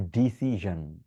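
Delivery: thick voiced d, buzzed zh in ডিসিশন; correct ZH pronunciation. Shown as a demonstration. Teacher correcting learners, slow zh in ডিসিশন. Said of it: "ডা sound মোটা করে আসবে".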